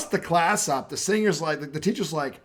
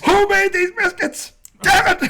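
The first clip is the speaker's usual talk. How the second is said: very high, loud, slow, laughing; hammed-up outraged customer; mock demanding customer; 10 dB louder than usual. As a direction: friend mocking Tom's biscuit question, loud and high. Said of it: "I didn't say it like that".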